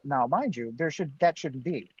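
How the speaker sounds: ordinary talk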